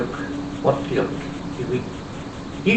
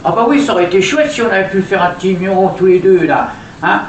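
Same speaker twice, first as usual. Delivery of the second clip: higher, louder, quicker puppet voice; 6 semitones higher, 7 dB louder, faster than usual. Acted. Puppet Julie to her owner, lively high doll voice. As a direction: chirp it out like a little doll.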